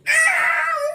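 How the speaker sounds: high squeal of delight; sudden high fangirl shriek; excited shriek. Real, not acted. Reader shrieks at romance scene.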